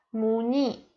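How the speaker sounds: ordinary delivery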